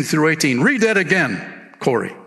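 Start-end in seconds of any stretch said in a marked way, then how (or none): none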